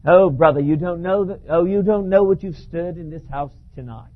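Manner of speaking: put-on a light English accent, worried; English-accented friend shaking his head; English-accented Baptist pastor warning the preacher. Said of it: "Glide's an English chap".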